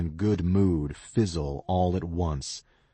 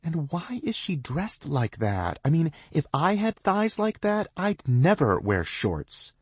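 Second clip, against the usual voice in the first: catty; 11 semitones higher than usual. A narrator's reading. Catty girl mocks thighs.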